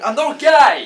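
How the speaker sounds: loud, high shout with a laugh in it; shouted denial. Acted. Adam denying it to his mates, loud laughing shout. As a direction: yell it out, half laughing.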